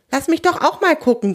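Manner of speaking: high, eager nagging; pushy pleading. A narrator's reading. Theo wants a look past his friend, pushy. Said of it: "drängelt Theo".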